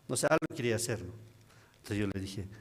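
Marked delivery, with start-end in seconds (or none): none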